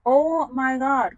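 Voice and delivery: slow, high, loud "Oh my God" of disgust; disgusted shock. Real, not acted. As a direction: recoil at the sight, stretching it out. Sees yellow film on water.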